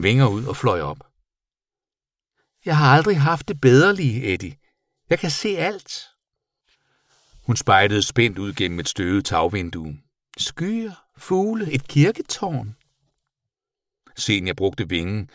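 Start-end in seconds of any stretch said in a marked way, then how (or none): 2.6-5.9 high little creature voice; delighted bat. A narrator's reading. Bat telling the boy she can see, delighted high voice. 10.4-12.8 high, wondering creature voice; name each sight in high-pitched wonder; marvelling bat. A narrator's reading. Bat looking out of dusty window, high wonder.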